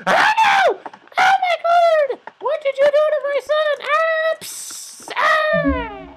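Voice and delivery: very high, loud mock screaming; hammed-up death screams; horrified mother. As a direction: shriek in falsetto as a horrified mom. Acted mother sees son killed.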